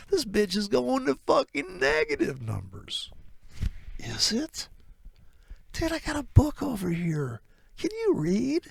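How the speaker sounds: high, scornful and superior; condescending trader. Acted. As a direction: talk down to the dumb friend. Trader insults doubter, high.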